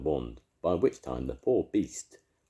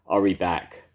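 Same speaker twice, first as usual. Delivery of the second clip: shout; 4 semitones higher, 9 dB louder than usual. A narrator's reading. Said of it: "shouted Arthur".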